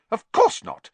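high, loud indignant denial; bristling. A narrator's reading. Matron denying the students left their beds, bristling. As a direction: snap it back, offended.

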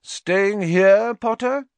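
loud, high taunting call; high-pitched bully's jeer; jeering shout. A narrator's reading. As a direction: shout it mockingly across a hall. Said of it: "shouted Malfoy".

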